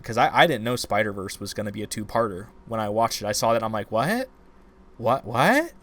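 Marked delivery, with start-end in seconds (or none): 3.9-5.7 higher and louder each time, mock shock; can't believe the movie just ended; repeated startled what, hammed up; stunned disbelief. Retold disbelief at the cliffhanger.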